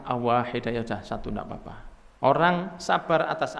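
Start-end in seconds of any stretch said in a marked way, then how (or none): 0.1-1.7 low, softening, accepting answer; calm reassurance. Acted. Prophet answering the follower that one is fine, calm reassurance. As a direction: settle the worry, low and easy. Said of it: "Rasulullah mengatakan".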